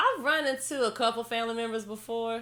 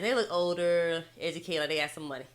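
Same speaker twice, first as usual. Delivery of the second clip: ordinary delivery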